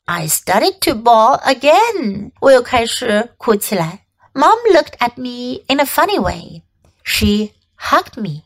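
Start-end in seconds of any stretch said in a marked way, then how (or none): none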